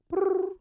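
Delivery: high, loud rolled trill; imitated phone ring. Recapper acts out the phone at the shop, imitated ring. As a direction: trill like a ringing telephone. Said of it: "the phone rings".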